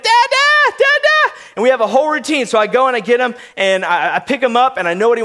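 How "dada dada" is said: put-on very high, loud toddler squeal; shrieked baby call for daddy; imitating toddler. Toddler calling dad from his crib, high mock shout. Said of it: "he's like".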